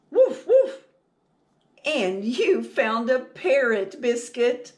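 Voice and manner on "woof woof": high, loud playful puppy woof; puppy barking. Acted. Teacher reading to children, barking as the puppy: high loud woofs. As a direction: bark like an excited little dog.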